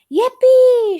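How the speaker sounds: very high, loud whoop; joyful shout. A narrator's reading. Grandpa Joe cheers as the elevator shoots up, loud whoop. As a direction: whoop with glee. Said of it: "shouted Grandpa Joe".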